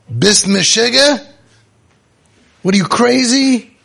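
father scolding his son in Yiddish; exasperated father. Retold or imitated. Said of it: "the father looks at him".

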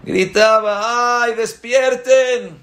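alto y lento, grito para despertar al pueblo; llama a los dormidos estirando cada palabra; shamash gritando. Retold or imitated. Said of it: "empezaba a gritar". (el shamash grita por las calles para despertar a todos, alto y lento)

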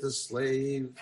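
plain reading voice

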